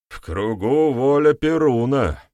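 high, loud, drawn-out cry; shouted proclamation. A narrator's reading. Shaman invokes Perun to settle the dispute, shouting. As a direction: cry it out over the crowd. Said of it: "выкрикнул Красный Лист".

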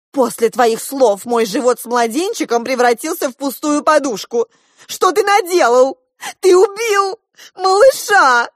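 high, loud accusation building to a wail; scolding. A narrator's reading. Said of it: "продолжала ругаться Диана". (ругаясь, всё выше и громче)

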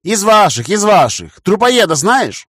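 loud, self-assured call; confident. A narrator's reading. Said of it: "уверенно сказал я".

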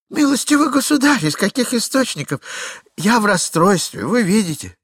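high, loud, flustered pleading; a frantic man begging to be understood; distraught husband. Acted. Husband pleading with the young man, high and flustered.

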